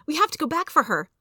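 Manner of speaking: high-pitched, urgent storybook exclamation; crying out. A narrator's reading. Henry urging Jessie to go back for Mother, crying out. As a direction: cry it out with mild urgency. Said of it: "he cried out".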